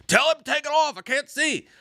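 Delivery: put-on high-pitched complaining old man. Old man nagging about a blocked view, high complaining voice. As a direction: complain like an old fan, voice pushed up. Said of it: "this dude was, like, Clint Eastwood's age".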